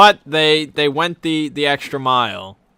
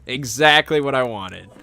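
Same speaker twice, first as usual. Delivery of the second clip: normal talking voice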